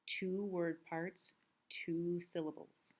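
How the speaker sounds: ordinary delivery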